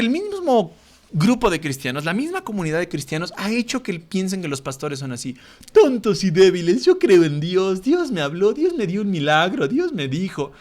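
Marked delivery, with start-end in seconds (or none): 5.7-10.5 mock dim-witted pastor, a bit higher; mocking the sitcom pastor's silly voice; dopey voice. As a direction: play the TV pastor as a simpleton. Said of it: "esas voces de sonso".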